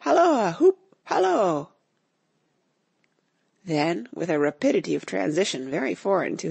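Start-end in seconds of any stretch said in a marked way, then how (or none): 0.0-1.7 loud, high hunting halloo; gleeful high hallooing cry; excited calling out. A narrator's reading. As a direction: shout after someone running away. Calling after running Friday.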